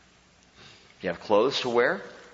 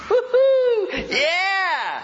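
very high, loud whooping "Woo-hoo, yeah!"; hammed-up ecstatic cheer with a laugh; giddy cheer; 6 dB louder, much slower than usual. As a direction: whoop it out like a giddy giver at the offering box. Pastor acts joyful giver.

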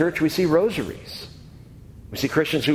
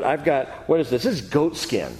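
ordinary delivery

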